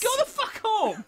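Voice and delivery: high, loud yell; angry shout. Real, not acted. Player yelling at game spoilers, angry shout. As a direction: bellow it high at them.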